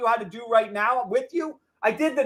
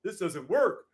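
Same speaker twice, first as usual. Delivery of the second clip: a touch of whiny, softer mockery of a critic; mocking critic; 4 semitones lower, 6 dB quieter than usual. Streamer mocking doubters of his system, mild whiny mockery. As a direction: mimic the doubters, dismissively. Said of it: "Have some balls if you say".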